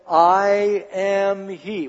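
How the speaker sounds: very slow, each word stretched out; solemn declaration. Acted. Jesus answers those arresting him, solemn declaration. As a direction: draw it out with immense weight.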